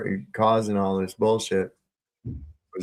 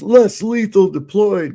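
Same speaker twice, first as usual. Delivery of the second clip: higher, louder barked police callout; mock cop callout; 10 semitones higher, 7 dB louder than usual. Cop getting out of his car with a shotgun, mock barked callout. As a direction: call it out like a cop on duty.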